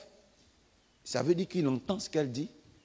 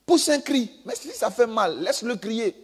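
mock command; 7 semitones higher than usual. Mocking minister's command.

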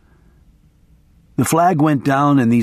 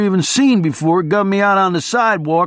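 high-pitched, worked-up barroom storyteller; boisterous guy telling his wild tale; rambling race fan; 7 semitones higher than usual. Acted. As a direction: hold forth to a crowd in a high voice. Voices rambling biker to crowd.

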